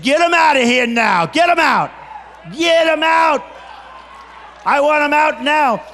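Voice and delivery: retold shout ordering a protester out; rough shout. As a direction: bark it like a tough boss. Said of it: "But I was rough".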